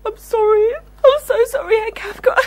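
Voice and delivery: tearful apology, pitched well up; crying. Acted. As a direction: sob out the apology. Assistant breaks down apologising.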